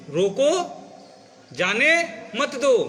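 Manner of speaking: रोको alone, then slow जाने मत दो; emphatic रोको, long break, measured जाने मत दो; pause after रोको. Shown as a demonstration. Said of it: "इसी शब्द को दूसरी जगह रुक जाओ".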